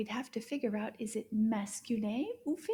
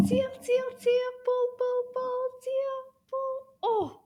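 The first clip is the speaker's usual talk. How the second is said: high, loud eager chant; excited pulling; 12 semitones higher, 5 dB louder, slower than usual. Acted. Teacher urging children to pull, high excited chant. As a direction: urge them to pull with glee.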